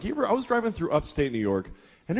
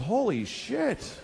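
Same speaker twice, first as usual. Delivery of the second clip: high, slow, stunned dawning; boy at camp realizing, stunned; shocked realization; 7 semitones higher, much slower than usual. Retold or imitated. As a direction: let it sink in with shock. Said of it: "I realized".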